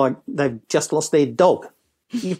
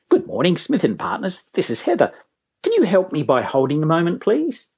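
lilting, welcoming phone greeting, lifted at the end; helpful receptionist; 4 semitones higher than usual. Shown as a demonstration. Receptionist asks caller to hold, friendly and helpful. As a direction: ask sweetly, rising high on please. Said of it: "That's a very different response from".

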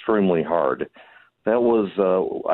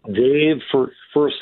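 hoarse, lost voice, louder; 6 semitones higher, 4 dB louder, slower than usual. Real, not acted. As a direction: push through a hoarse throat. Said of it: "I've lost my voice already".

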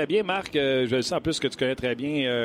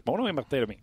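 ordinary talk, nothing marked

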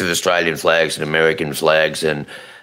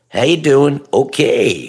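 hammed-up American "how you doing"; mock American accent; 5 semitones higher, slower than usual. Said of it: "we can always come up with a".

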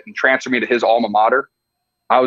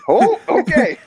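put-on panicked young self; much slower than usual. Imitating his overmatched freshman self facing defensive ends, high and panicked. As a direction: squeak it out like a freshman who just got flattened.

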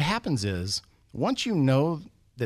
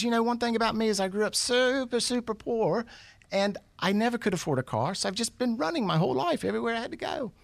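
high-pitched mock Aussie storyteller; amateur Australian impression, high and earnest; bad Australian accent; 11 semitones higher than usual. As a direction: explain it in a put-on Australian voice, way up high. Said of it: "my bad attempt at an Australian accent".

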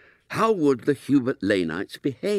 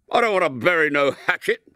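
put-on stormy, indignant boy's protest; lightly voiced schoolboy stamping his foot; tempestuous; 6 semitones higher, 3 dB louder, faster than usual. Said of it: "said William tempestuously".

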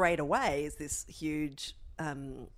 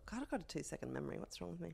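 talking to herself; 12 dB quieter, faster than usual. Real, not acted. Podcast host chiding herself over her memory, muttered aside. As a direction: drop right down, as if off to one side. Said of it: "I'm just reminding myself".

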